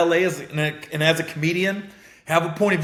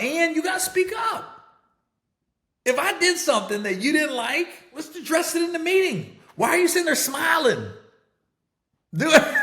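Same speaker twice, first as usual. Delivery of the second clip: imagined telling-off; 12 semitones higher than usual. Pretend. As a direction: yell it at her like a frustrated lecture. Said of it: "I'm like".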